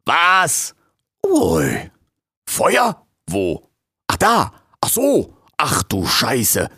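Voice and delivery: slow, stunned swear at the end; startled raccoon. Acted. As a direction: realise with a start that it's fire. Raccoon sees fire.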